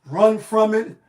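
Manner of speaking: loud dramatic Thanos impression; Thanos quote. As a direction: proclaim it like a doomed fate. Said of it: "how Thanos said".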